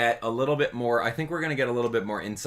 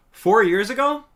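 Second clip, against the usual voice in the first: high, loud disbelieving question; can't believe it's been that long; startled cry of disbelief; shocked disbelief; 13 semitones higher, 9 dB louder than usual. Real, not acted. Shocked at how old the video is.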